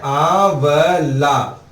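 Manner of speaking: "आंवला" drawn out slowly with a falling tune; slow stressed answer. Shown as a demonstration. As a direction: stretch "आंवला" out for the class to repeat. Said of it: "क्या होता है?". (धीमे, खींचकर "आंवला")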